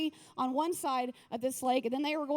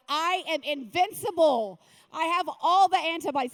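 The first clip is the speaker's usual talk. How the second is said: loud, hammed-up proclamation; invincible boast; 3 semitones higher, 10 dB louder than usual. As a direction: proclaim it grandly, much louder. Acted boast after having covid.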